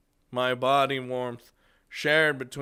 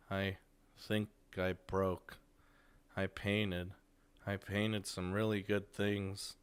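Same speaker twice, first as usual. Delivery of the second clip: lifeless voice; 6 dB quieter than usual. Acted. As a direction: say it softly, with the life gone out. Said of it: "It's a lifeless voice".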